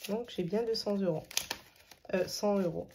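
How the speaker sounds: ordinary talk, nothing marked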